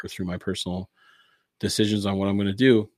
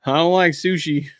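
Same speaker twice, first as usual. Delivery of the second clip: higher, louder comic questioning; hammed-up squeamish follower, pitched up; literal-minded disciple; 7 semitones higher, 6 dB louder than usual. Disciple asking about eating flesh, comically literal-minded.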